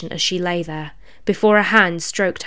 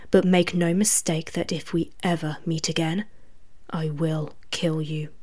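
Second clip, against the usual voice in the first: whispering; 7 dB quieter than usual. A narrator's reading. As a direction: whisper a warning into her ear. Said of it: "kneeling to whisper in her ear".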